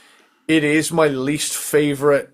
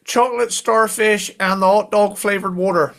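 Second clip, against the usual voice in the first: Cornish accent; 6 semitones higher than usual. Shown as a demonstration. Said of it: "bit more Kerno going on".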